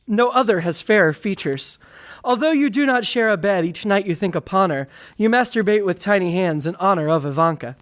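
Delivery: ordinary talk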